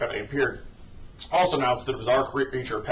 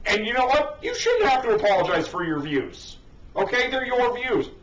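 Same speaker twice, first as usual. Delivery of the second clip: much higher, exasperated rant; high-pitched indignation; 10 semitones higher than usual. Real, not acted. Commentator defending people's views, high and indignant. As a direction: protest it in a high, insistent pitch.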